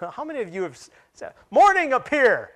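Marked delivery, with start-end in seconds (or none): 1.5-2.5 high, loud mock command to the sky. Preacher playing someone ordering the sunrise, mock commanding shout. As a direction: call it out like a grand, hammed-up decree.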